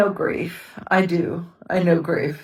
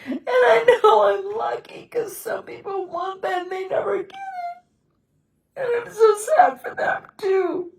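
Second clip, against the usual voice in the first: high, breaking voice, sobbing through the words; real grief, crying as she speaks; 13 semitones higher than usual. Real, not acted. Widow telling viewers what was taken from her, sobbing. Remembering her marriage and lost husband.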